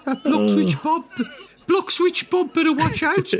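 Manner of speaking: very high falsetto caricature; hammed-up, high-pitched character voice; comic put-on voice. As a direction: squeal it in a silly falsetto.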